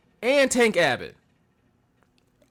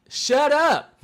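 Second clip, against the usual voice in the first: high, exasperated telling-off; mock-outraged and high-pitched; exasperated retort; 10 semitones higher, 3 dB louder than usual. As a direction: scold an annoying announcer. Repeats retort to absent announcer.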